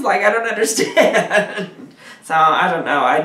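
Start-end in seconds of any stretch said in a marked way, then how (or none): 0.0-1.7 laughing through the words. Real, not acted. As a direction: laugh in disbelief. Laughing disbelief.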